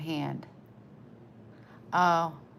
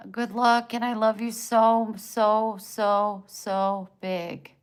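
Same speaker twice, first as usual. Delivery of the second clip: louder, lifted, drawing out each so; gushing; 2 semitones higher, 7 dB louder, slower than usual. Real, not acted. Streamer gushing love to a viewer, louder. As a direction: pour affection on them.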